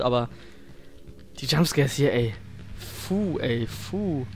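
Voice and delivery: ordinary delivery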